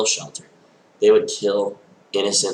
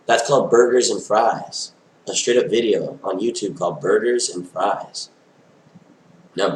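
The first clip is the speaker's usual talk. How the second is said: normal talking voice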